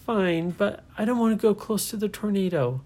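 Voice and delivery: put-on reluctant girl not wanting to go; scared little girl. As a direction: shrink back, a nervous girl. Nora giving in, high.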